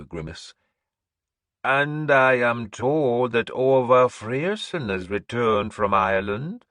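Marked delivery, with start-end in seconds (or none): 1.6-6.7 slow, high, loud proclamation; old seeress announcing. Acted. Seeress announcing to the hall, high and slow. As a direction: proclaim like an eerie prophetess. Breda speaks.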